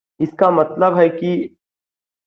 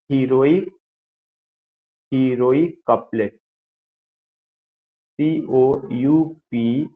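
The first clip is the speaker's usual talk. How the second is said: slow, spaced-out dictation; 3 semitones lower, much slower than usual. Acted. Teacher dictates heroic couplet for students to write, slow dictation. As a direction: spell it out for note-takers, slowly.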